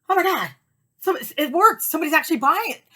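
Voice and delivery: high, loud gasping excitement; thrilled disbelief. Retold or imitated. Seller reacting to a first tour purchase, thrilled disbelief. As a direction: burst out in amazed delight.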